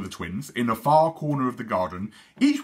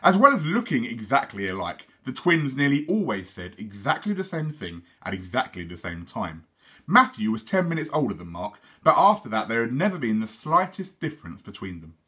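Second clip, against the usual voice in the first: ordinary delivery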